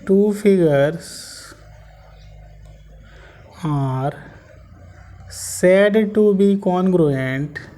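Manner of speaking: drawn-out dictation with long pauses; very slow dictation. Shown as a demonstration. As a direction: spell it out phrase by phrase for copying. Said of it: "very important line".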